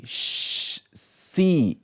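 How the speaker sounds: a soft whispered sh- gliding into sea; soft hushing demo. Shown as a demonstration. Teacher imitating the sound of waves softly.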